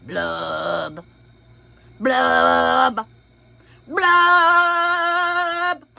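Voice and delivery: rising sung blub blub blub; mock fish blubs. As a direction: bubble it out like a fish. Fish bubbling sounds.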